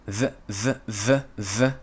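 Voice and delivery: isolated th sound in short repeats. Shown as a demonstration. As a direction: say "th, th" as separate voiced consonant sounds. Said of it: "and that's".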